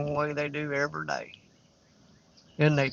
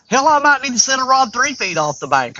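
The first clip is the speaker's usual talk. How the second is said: higher, louder eager plan; louder, higher excited aside; 7 semitones higher, 6 dB louder than usual. Real, not acted. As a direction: still buzzing from the jump. Still excited about fish.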